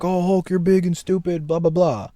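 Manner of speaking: high, mocking whiny Banner voice. Banner taunting Hulk, high mocking whine. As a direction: sneer it like nerdy Banner teasing. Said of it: "Banner's like".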